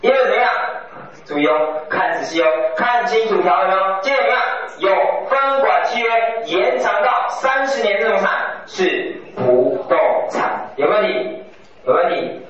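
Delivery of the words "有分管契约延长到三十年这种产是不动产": 有分管契约延长到三十年 pitched high, then 是不动产 drawn out; emphatic key point. Real, not acted. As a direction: land slowly and deliberately on 不动产. Warns students to note the key condition. (强调重点，“是不动产”放慢拖长)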